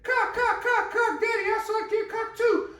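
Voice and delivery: put-on very high, loud squeaky little kid voice; mock toddler parroting words at his dad. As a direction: chirp it like a two-year-old. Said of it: "So then he said".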